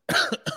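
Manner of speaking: harsh real cough; coughing fit. Real, not acted. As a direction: cough hard, choking. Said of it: "I'm over here choking".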